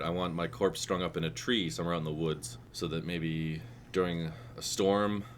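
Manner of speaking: ordinary talk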